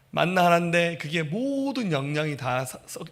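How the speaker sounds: usual speaking voice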